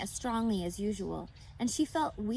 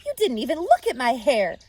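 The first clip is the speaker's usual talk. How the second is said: indignant; 4 semitones higher, 10 dB louder than usual. A narrator's reading. Wife complains.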